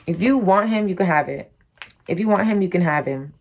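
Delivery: normal talking voice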